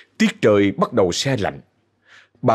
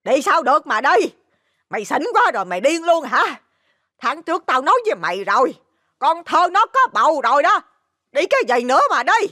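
high-pitched motherly scolding; exasperated, high outcry; indignant yelling. Acted. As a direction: shout down your drunken son. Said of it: "bà Bảy liền la lên".

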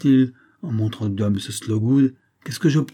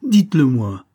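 much louder, demanding shout; forceful cry; 3 semitones higher, 8 dB louder than usual. A narrator's reading. Young man grabs the major's wrist, shouting his demand. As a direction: demand it, now yelling. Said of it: "s'écria avec force".

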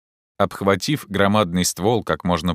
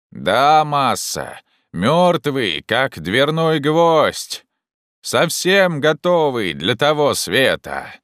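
slow, higher, drawn-out call; 7 semitones higher, 4 dB louder, much slower than usual. Acted. Jupiter confirms branch is dead, calling down. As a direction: draw the words out to carry. Climber answers from the tree.

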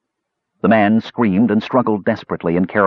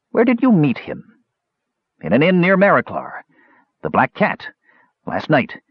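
higher, hurried pleading; eager frightened thief; 7 semitones higher than usual. A narrator's reading. Captured thief pleads eagerly with the mage. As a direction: say anything to be spared.

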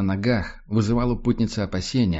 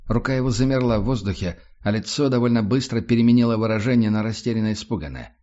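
plain reading voice

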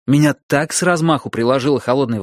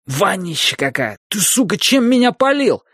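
disgusted yell; 7 semitones higher than usual. Acted. Drenched friend yelling in disgust.

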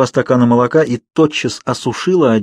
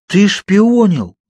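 slow, higher, louder mock accusation; not angry enough; 9 semitones higher, 4 dB louder, much slower than usual. A narrator's reading. Bella accuses Edward of spying, half-hearted mock anger. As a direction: pretend outrage that is secretly flattered. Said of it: "прозвучал недостаточно сердито".

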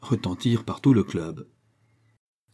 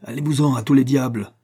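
raised, loud send-off; cross, higher and louder; angry dismissal; 5 semitones higher, 5 dB louder than usual. A narrator's reading. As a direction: send them packing angrily. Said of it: "en colère et frappant du poing".

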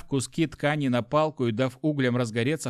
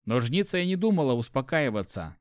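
ordinary delivery, nothing marked

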